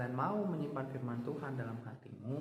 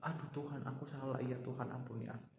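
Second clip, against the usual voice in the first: softer, contrite plea; humble prayer; 7 dB quieter than usual. Retold or imitated. David repenting to God, soft and contrite. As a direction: confess quietly to God.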